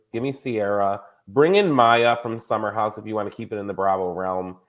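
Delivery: normal talking voice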